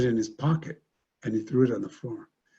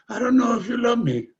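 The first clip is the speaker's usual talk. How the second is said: high pleading voice; 11 semitones higher, 4 dB louder than usual. Retold or imitated. Brother's vulnerable reply, retold.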